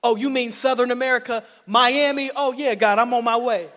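high, loud eager agreement; eager yes. Pretend. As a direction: jump at the chance to go to Miami. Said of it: "some of us would be like".

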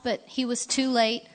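usual speaking voice

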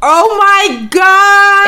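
high, slow, drawn out oh my God; hearing the sock came out whole; shocked. Real, not acted. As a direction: stretch it out in real disbelief. Shocked reaction to sock story.

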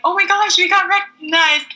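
high excited gush; thrilled. Retold or imitated. The girls noticed by JC, high thrilled gush. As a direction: squeal with surprise.

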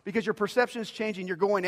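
fiery pulpit appeal; fervent preaching. Acted. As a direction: press the point home like a preacher. Preaching at full force.

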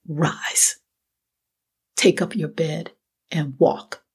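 measured, whispery command with long pauses; hushed authority. Acted. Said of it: "in authority. I mean, he could have said it like that".

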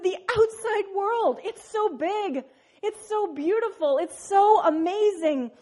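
high, slow, awestruck frog voice; amazed little frog. Acted. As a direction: marvel at each word slowly. Frog marvels at the world.

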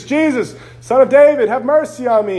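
high, loud cry for mercy; imitated yell. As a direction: call out as if yelling to be heard. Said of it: "He's yelling to Jesus".

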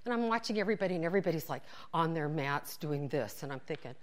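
ordinary talk, nothing marked

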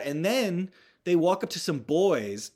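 usual speaking voice

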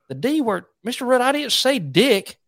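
high, innocent buck-toothed schoolboy; Dalton, country kid. Retold or imitated. Second grader protesting to his teacher, high country kid voice. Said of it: "he talked like this 'cause he had two buck teeth".

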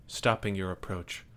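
ordinary talk, nothing marked

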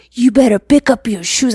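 mock-serious parental telling-off; big old deal. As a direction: bark it at the kid like it's a crisis. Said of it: "and I make a big old deal".

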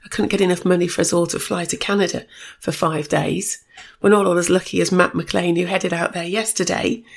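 ordinary delivery

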